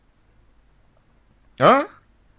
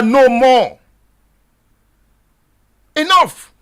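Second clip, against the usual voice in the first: protest shout; 2 semitones higher, 5 dB louder, slower than usual. Shown as a demonstration. Host urging listeners on Okadas, loud protest shout. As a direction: yell it from the back of an Okada. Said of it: "begin to scream it on your Okada".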